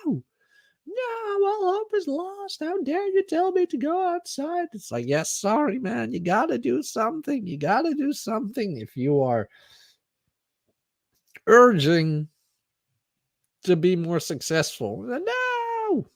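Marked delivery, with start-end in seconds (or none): 0.1-4.7 high, falsetto mock wail of despair; hammed-up high-pitched outrage; mock outraged whiner. Mock outraged Twitter users. 5.1-15.0 high, light put-on voice; playful high mock apology; mock apologetic reply. Mock reply to outraged users. 15.0-16.1 high, falsetto mock wail of despair; hammed-up high-pitched outrage; mock outraged whiner. Mock outraged Twitter users.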